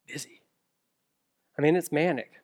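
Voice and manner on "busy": whispered. Retold or imitated. Answer people give.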